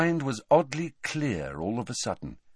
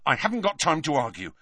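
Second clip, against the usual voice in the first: higher, fast brush-off; curt; 6 semitones higher, faster than usual. A narrator's reading. Boy in a hurry silencing his friend, curt. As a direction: shut down the argument quickly. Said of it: "said Harry curtly".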